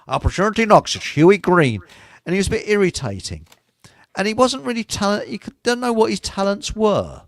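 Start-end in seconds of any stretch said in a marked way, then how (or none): 0.1-1.8 a louder, raised game-show host call with an odd Canadian twang; Canadian-accented host impression. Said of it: "this really strange Canadian accent".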